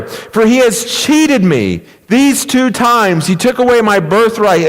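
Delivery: high-pitched, emphatic preacher's reading; impassioned scripture reading. Acted.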